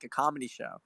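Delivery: normal talking voice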